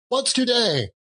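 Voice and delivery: high excited call downward; cried out. Acted. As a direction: shout down eagerly from the window. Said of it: "cried Scrooge".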